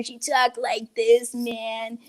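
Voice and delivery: slow, dragged-out stoner voice; stoner art teacher. Acted. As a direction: imitate a spaced-out stoner. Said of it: "who was a stoner".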